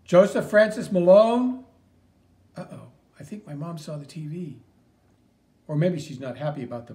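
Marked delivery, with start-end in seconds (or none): 0.0-1.6 loud, high, slow angry call of the full name; the furious mother summoning her son; full-name scolding. Real, not acted. Mother yelling her son's full name in anger.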